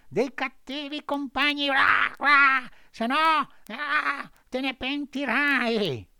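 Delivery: very high, loud, cawing bird voice; squawking blackbird. A narrator's reading. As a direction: warn the puppet between squawks. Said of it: "fece il suo solito verso e disse".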